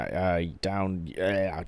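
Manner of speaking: usual speaking voice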